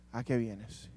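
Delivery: low, soft and quiet question; soft gentle Jesus voice. Acted. Jesus gently questioning Judas, soft and low. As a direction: ask it gently, without judging. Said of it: "está confrontando con la verdad".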